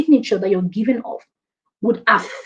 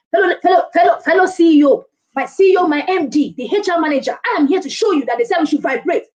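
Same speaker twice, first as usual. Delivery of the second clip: over-the-top acting; 6 semitones higher than usual. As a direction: overdo the energy like a showy performer. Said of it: "I don't now mean that you should now start acting".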